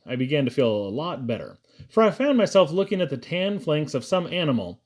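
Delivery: usual speaking voice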